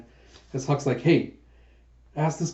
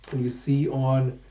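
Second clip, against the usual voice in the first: usual speaking voice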